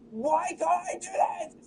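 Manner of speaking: high, slow soft whine; whining. Real, not acted. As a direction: moan it out in frustration. Frustrated whine.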